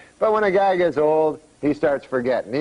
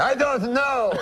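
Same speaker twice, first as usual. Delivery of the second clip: put-on high, drawn-out French-accented answer; stumped uncle in French accent, slow; 8 semitones higher, slower than usual. Uncle stumped by cabbie's riddle, slow French accent. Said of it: "my uncle goes".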